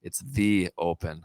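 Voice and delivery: THE drawn out slowly before Open; stress on THE. Real, not acted. Host insisting on the proper tournament name, stress on THE. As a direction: lean slowly on THE, as the one and only.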